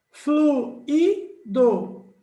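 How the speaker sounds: high, loud, drawn-out syllables; syllables split. Shown as a demonstration. Teacher showing the wrong split, slow and emphatic. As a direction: pull the word apart slowly into syllables. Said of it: "essa separação só estaria certa".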